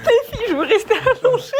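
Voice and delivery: high laughing voice. Real, not acted. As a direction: giggle through the words. Laughing while pinned by son.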